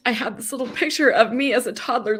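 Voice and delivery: normal talking voice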